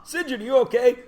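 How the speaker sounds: alarmed parent. Retold or imitated. Parent calling to screaming kid.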